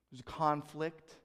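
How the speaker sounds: ordinary delivery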